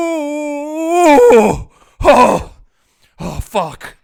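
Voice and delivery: high, loud hooting monkey voice; monkey impression. Shown as a demonstration. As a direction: howl like a distressed monkey. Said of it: "That's my impression of a monkey".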